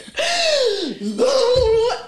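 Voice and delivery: high, loud imitated wail with gasp; gasp, then imitate a loud crying howl; mock blubbering wail. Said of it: "she just full on blubbering".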